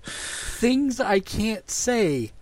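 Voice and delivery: high, very slow and drawn out; coy singsong dodge. Pretend. Host hinting at things they can't say, coy drawl. Teasingly dodging a spoiler.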